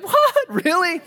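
high, loud incredulous squeak with a laugh; a jealous crowd gasping in surprise; mock disbelief. Waiting crowd reacts in disbelief.

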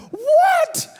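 stunned what. Retold or imitated. Speaker imitating disbelief at Billy's naivety, shrill mock shock. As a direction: squeal it in disbelief. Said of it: "it was just like".

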